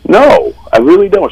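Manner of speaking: put-on denying an accent to the clerk, indignant; mock indignant denial. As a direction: protest that you have no accent. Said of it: "And I went".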